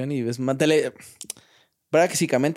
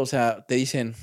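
ordinary talk, nothing marked

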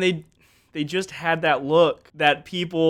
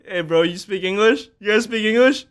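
awkward question; 7 semitones higher, 5 dB louder than usual. Retold or imitated. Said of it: "I was like".

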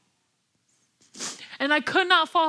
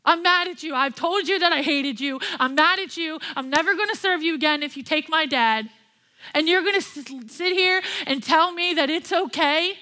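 angry retort; 2 semitones higher than usual. Retold or imitated. Daughter furious at God for her dad's illness. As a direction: demand an answer, heated. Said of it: "made me even more mad".

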